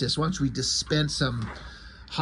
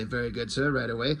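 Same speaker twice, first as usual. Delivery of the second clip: lower, softer robotic butler reply; a hint of a car computer answering; lightly voiced machine politeness; automated voice; 4 semitones lower, 5 dB quieter than usual. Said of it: "the automated voice say".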